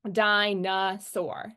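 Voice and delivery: dinosaur said slowly, syllable by syllable; slow syllable demo. Shown as a demonstration. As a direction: draw out dinosaur to show the unstressed middle. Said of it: "our unstressed syllable is gonna be that middle one".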